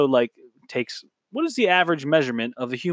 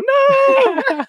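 shrill high shriek, much higher and louder; 7 dB louder than usual. Retold or imitated. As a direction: shriek it like a horror movie heroine.

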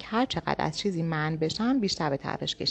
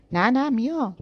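hurried, higher and louder eager reply; said quickly; 6 semitones higher, 6 dB louder than usual. A narrator's reading. Girl hastily insists she'll come along, eager and loud. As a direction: blurt it out fast before he changes his mind. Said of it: "سریع گفتم".

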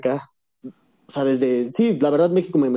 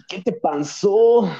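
mocking pity; 6 semitones higher, slower than usual. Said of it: "y es así como de".

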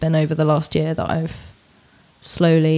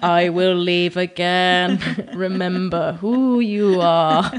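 slow, solemn mock-majestic father; Lion King dad voice; much slower than usual. Joking about a dad on the ceiling, slow mock-solemn voice. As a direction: intone it slowly like a ghostly king. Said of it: "your dad's on the ceiling".

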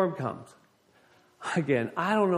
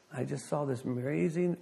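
normal talking voice

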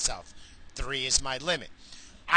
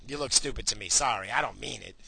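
normal talking voice

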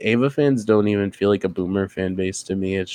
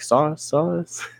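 rising, higher seagull-like repeated call; Finding Nemo seagulls; 8 semitones higher, 3 dB quieter than usual. Acted. Hentai fan likened to seagulls begging for sauce, gull impression. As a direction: imitate begging gulls, pitch climbing on each call. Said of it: "the seagulls from Finding Nemo".

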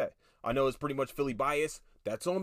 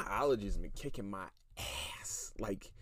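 whispered aside; 7 semitones lower, 8 dB quieter than usual. Real, not acted. Complaining about allergies, hushed.

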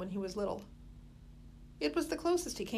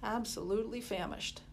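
plain reading voice

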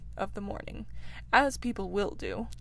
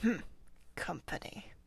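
much lower, very soft grumble; low grunt; 3 semitones lower, 8 dB quieter than usual. A narrator's reading. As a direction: grunt it under your breath, unwelcoming. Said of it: "then grunted".

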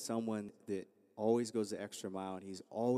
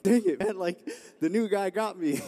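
louder, higher, a hammed-up groan of defeat; mock frustrated outburst; 10 semitones higher, 12 dB louder than usual. Knocked down by the new kid, mock frustrated outburst. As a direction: sell the sting of being beaten by the rookie.

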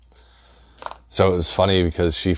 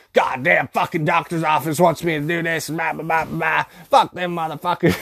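high, crackly grumbling rant; cussing old lady; 10 semitones higher than usual. Retold or imitated. Grandma after hanging up on the doctor's office, cranky old lady rant. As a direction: imitate grandma dropping the sweet act to cuss. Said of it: "then she hangs up the phone".

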